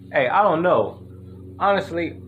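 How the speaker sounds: ordinary talk, nothing marked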